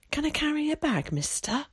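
put-on high-pitched child's question; small boy asking a grown-up, pitched well up; young boy. A narrator's reading. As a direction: pipe up like a little lad. Small boy asks old man.

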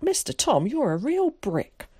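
high, gushing praise; thrilled, grateful boy; enthusiastic cry. A narrator's reading. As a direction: exclaim it high, slowing on the compliment. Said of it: "cried Zack".

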